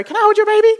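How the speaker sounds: high, quick question; mock eager request. Speaker imitating asking a parent to hold the baby, mock eager. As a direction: pitch it up into an over-keen ask. Imagined forward request to a stranger.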